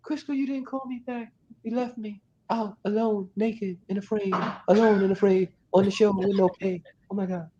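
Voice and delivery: very high, whiny complaint about being left alone; mock needy wail. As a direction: play the clingy abandoned friend. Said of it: "if I call you and we talk like".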